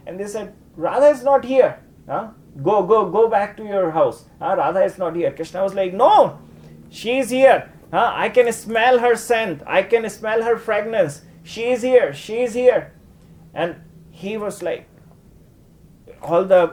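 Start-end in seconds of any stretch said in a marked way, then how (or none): 0.8-5.3 higher, dismissive; lightly voiced gopis sending him away; friends brushing him off. Friends voiced turning Krishna away. 5.9-12.9 higher and louder, insisting; Krishna insisting, high and urgent; insistent Krishna. Acted.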